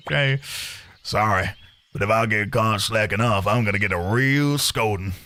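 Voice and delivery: lightly drawled Southern Texan, a bit sheepish; a hint of Texan, worried about the chief; Southern accent. Tony fears a scolding, mild Southern accent. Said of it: "what accent for Tony?".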